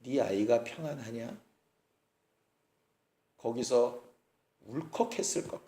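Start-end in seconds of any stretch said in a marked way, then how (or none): 0.0-1.3 네 아이가 평안하냐 said much softer and lower, weighed down; hushed heavy question. Retold or imitated. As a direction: drop the voice and ask 네 아이가 평안하냐 as if it hurts. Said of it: "맨 마지막이 문제입니다".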